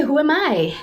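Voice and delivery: ordinary talk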